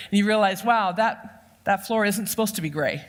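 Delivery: normal talking voice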